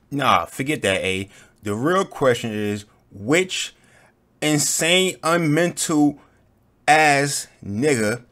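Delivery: loud, worked-up ranting. Real, not acted. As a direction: yell it out in disbelief. Loud rant about horse question.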